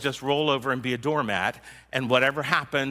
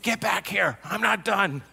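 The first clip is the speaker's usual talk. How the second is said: higher, mock angry demand; imitating an angry yell; 6 semitones higher than usual. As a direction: play back the person who was shouting. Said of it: "somebody yelling and screaming at me".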